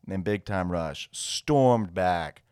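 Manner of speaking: dramatic play-by-play recap; sportscaster. Acted. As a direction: call it like a SportsCenter comeback. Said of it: "I feel like I'm on SportsCenter".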